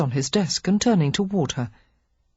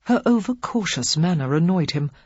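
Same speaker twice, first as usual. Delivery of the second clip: ordinary delivery, nothing marked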